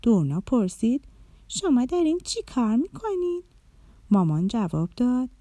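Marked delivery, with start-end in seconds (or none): 1.5-3.4 a lightly voiced little girl, a bit higher; light child voice asking mom; child's question. A narrator's reading. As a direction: ask like a curious small child. Child Dorna asks her mother.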